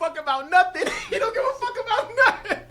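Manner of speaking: high, fast laughing line. Real, not acted. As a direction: crack up mid-line. Laughing imitation of character.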